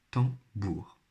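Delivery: "Tambour" broken into tam, then bour; syllable by syllable. Shown as a demonstration. Teacher sounding out a word for pupils, syllable by syllable. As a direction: say "Tambour" in two separate beats. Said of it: "colorier là où les syllabes".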